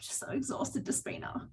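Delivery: whispered, drained of energy; a retold exhausted whisper; weary whisper. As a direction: whisper it like you have nothing left. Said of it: "I was saying".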